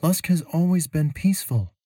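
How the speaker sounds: put-on high, lifted young woman's voice; Mira's voice. A narrator's reading. Traveler telling her companion she's puzzled, in a high worried voice. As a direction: voice Mira up high, puzzled and uneasy. Mira speaks.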